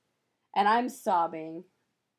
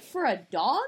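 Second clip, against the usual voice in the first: incredulous; 5 semitones higher, 6 dB louder than usual. Retold or imitated. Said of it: "And I'm like".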